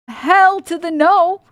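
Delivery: sassy refusal. Real, not acted. Refusing with sass.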